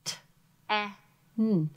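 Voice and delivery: t, e, n sounded out sound by sound; sounded-out syllable. Shown as a demonstration. Teacher sounding out the second syllable. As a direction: sound t, e, n separately. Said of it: "short E".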